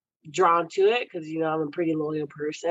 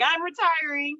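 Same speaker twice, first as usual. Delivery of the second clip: mock coach announcement; 10 semitones higher than usual. Quoting coach's claim.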